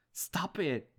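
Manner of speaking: sharp high cry of stop it; anguished cry. Acted. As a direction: cry out for it to end. Anguished outburst at dad.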